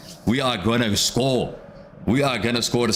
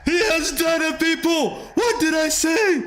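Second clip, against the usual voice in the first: goal celebration. Real, not acted. Fan streamer calling Garnacho's goal to chat, ecstatic rising shout. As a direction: scream it like your team just scored the winner.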